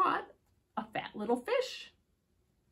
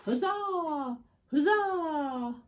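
high, loud cheer; cheering bees; 6 semitones higher, 6 dB louder, slower than usual. A narrator's reading. Bees cheering the flying bird, loud. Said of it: "The bees said".

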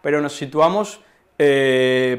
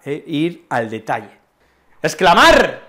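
ordinary talk

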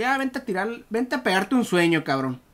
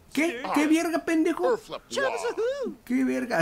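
incredulous; 9 semitones higher, 3 dB quieter than usual. Real, not acted. Baffled by what happens in game.

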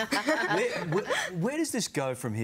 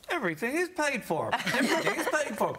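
checkout voice; 10 semitones higher than usual. Retold or imitated. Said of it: "I'd go".

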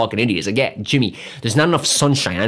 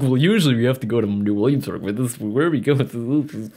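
mocking frat boys; 2 semitones lower than usual. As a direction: mimic entitled newcomers, slowing down and dropping away.

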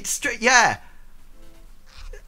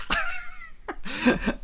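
high laugh. Real, not acted. Laughs at own story.

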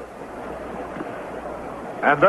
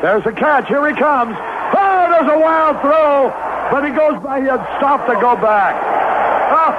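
excited call; 7 semitones higher than usual. Real, not acted. Announcer calling a wild throw home, excited. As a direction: shout the throw and the runner.

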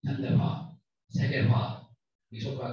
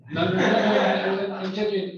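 laughing; 8 semitones higher, 8 dB louder than usual. Real, not acted. Laughing protest at suggested answer.

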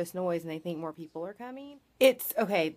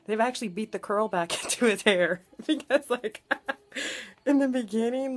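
talking through giggles, higher; real laughing; 3 semitones higher, 3 dB louder than usual. Real, not acted. Telling viewers about his curly hair, real laughing. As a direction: crack up mid-sentence.

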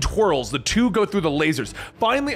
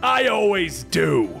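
growling Namor; 3 semitones higher, slower than usual. Acted. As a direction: growl it out, slow and heavy. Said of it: "Namor growls".

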